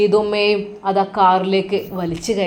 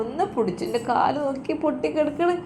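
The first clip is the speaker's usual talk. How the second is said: put-on a higher, whining old woman's voice; whiny injured old mother; 6 semitones higher, 3 dB quieter than usual. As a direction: complain in pain, pleading. Mother cries about broken leg.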